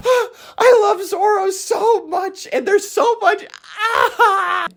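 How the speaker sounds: high, drawn-out tearful gushing; real emotional squeal, pitch way up; overcome with emotion. Real, not acted. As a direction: wail your love, ending in a cry. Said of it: "I'm getting emotional right now".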